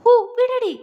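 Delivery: short, high, loud yelp of pain. A narrator's reading. Husband yelping at his wife tugging his mustache, loud pained yelp. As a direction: yelp out as your mustache is yanked.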